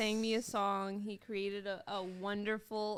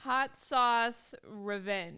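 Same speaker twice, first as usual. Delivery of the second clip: slow announcement; much slower than usual. Acted. Reading the sauce name slowly.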